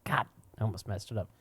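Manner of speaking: quick whisper to himself; whispered self-correction; whispered aside. Real, not acted. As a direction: breathe it under your breath. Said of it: "aside to himself".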